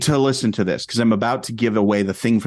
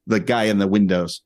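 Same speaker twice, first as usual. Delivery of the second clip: ordinary delivery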